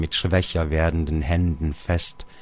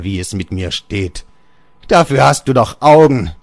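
put-on louder, higher accusing old man; bitter father; 3 semitones higher, 6 dB louder than usual. Father reproaches Georg with bitter anger. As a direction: hurl blame for the mother's death.

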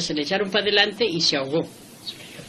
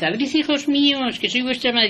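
wolf faking a mother's voice, pitched higher; wolf's put-on finer voice; 6 semitones higher than usual. Wolf fools the little goats at the door, high faked mother voice. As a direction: sweeten it to pass as the mother goat. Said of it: "a poner la voz más fina".

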